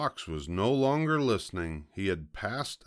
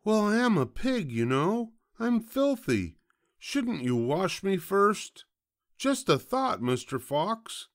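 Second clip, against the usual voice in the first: very high piglet voice; wheedling piglet; 13 semitones higher, 3 dB louder than usual. Acted. Piglet talking the fox into a bath, high and wheedling. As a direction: suggest it innocently, pitched way up.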